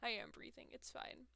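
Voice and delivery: breathless whisper; hushed, barely breathing. Real, not acted. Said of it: "Gray, breathe".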